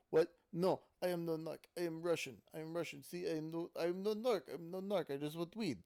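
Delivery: stupid fake Russian accent. Weird kid denying he's a narc, fake Russian accent. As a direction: protest in a badly faked Russian accent. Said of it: "putting in his stupid fake accent".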